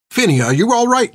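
put-on high, anxious call to the child; worried nun. A narrator's reading. Sister Carmeline hurrying to Finn, worried. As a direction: rush to the child in worry.

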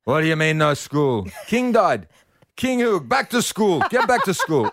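high, sharp mock scolding mother; hammed-up mum sending him back. Mother orders her boy back to school, high and scolding. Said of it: "And she goes".

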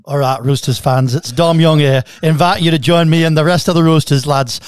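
a lightly hammed-up Yorkshire accent; mock Northern English accent. Radio host doing a Yorkshire accent for the guest. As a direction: read the ad in a mild put-on Yorkshire accent. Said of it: "who's got the better accent".

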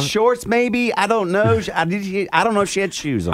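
high, unsure goofy dad voice; guessing dad, totally lost; clueless dad. Retold or imitated. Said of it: "you imagine the dad out there".

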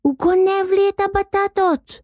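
very high outraged squeal; נוצי screeching. A narrator's reading. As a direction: screech an accusation like a girl. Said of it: "צרחה נוצי". (צווחה גבוהה וזועמת)

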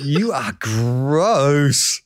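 drawn-out, deadpan judgement; slow mock verdict. Friend mock-judges the therapist's patient, slowly and deadpan. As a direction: stretch it out, slowly.

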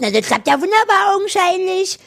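high, loud sarcastic jab. Retold or imitated. Sarcastic retort retold.

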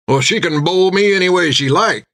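a hint of a rough drunk patron; lightly voiced tipsy tavern man; gruff drunk man. A narrator's reading.

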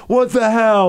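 mock the wrestler's irritation; upset. Wrestler upset at the announcer for a botched intro, mildly angry. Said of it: "upset at me".

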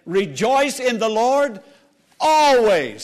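fervent pulpit proclamation; emphatic exhortation. Real, not acted. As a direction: proclaim it and land hard on always. Repeats the verse with more force.

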